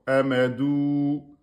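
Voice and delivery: Amadou with the final ou held for two beats, slowly; reading Amadou slowly for a class, long ou held. Shown as a demonstration. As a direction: stretch the ou in Amadou as you teach. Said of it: "on a bien le deux temps".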